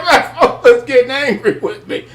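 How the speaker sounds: high-pitched laughter through the words; giggling through the memory; laughing. Real, not acted. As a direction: laugh while you tell it.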